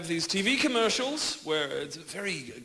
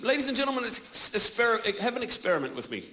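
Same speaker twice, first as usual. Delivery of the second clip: ordinary delivery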